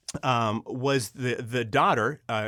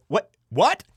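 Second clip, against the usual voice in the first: high, loud double-take What; astonished; 13 semitones higher, 4 dB louder than usual. Retold or imitated. Director spotting Sean Astin in his studio, astonished. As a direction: gasp out each What in disbelief.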